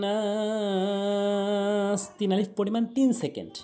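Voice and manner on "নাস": নাস held very long; three-alif stretch. Shown as a demonstration. Teacher shows how long to hold নাস. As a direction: draw out নাস for three counts. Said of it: "এরকম time টানবেন".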